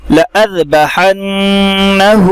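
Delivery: लअज़बहन्नहु recited slowly, no alif after ला; Quranic recitation. Shown as a demonstration. Teacher modelling the word, slow recitation. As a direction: chant लअज़बहन्नहु in tajweed. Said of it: "लअज़बहन्नहु पढ़ेंगे".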